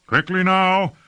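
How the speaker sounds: high, urgent commander's call. A narrator's reading. Lord hurries his troops out of the gates, calling out. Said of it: "called Lord Fayed".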